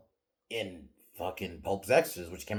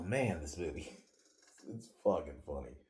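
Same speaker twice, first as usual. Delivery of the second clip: ordinary talk, nothing marked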